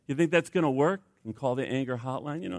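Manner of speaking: ordinary talk, nothing marked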